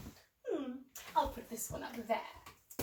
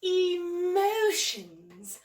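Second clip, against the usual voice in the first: gasp, then draw the word out very slowly and louder; gasping reveal; 4 semitones higher, 6 dB louder, much slower than usual. Acted. Host revealing the theme to children, gasp and drawn-out word. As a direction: unveil it like a treasure.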